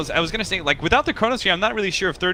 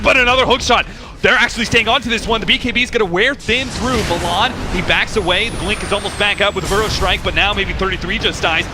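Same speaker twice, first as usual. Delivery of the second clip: raised, urgent chase call; excited play-by-play; 7 semitones higher, 3 dB louder than usual. Acted. Caster calling the chase, raised and urgent. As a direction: push the energy as he hunts.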